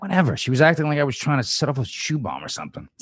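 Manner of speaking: high, dismissive whine. Acted. Dan dismissing his girlfriend's complaint, high whiny scoff. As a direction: brush her off with a scoff.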